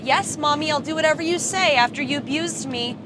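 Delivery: ordinary talk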